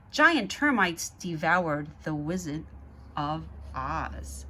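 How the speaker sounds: put-on lively read-aloud to children; story narration. A narrator's reading. Reading the Zoobrary book to kids, lively narration. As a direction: read it like a bedtime picture book.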